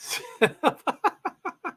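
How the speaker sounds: high-pitched run of giggles; helpless high giggling. Real, not acted. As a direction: laugh in a long, high titter. Amused by her dog impression.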